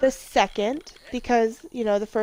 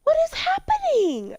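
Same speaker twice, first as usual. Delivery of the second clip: bewildered shriek. Real, not acted. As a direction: squeal in baffled panic. Sims characters argue unexpectedly.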